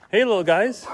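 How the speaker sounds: high, loud and bright call to small animals; cheery greeting. Real, not acted. Greeting little animals on his walk, high-pitched and loud.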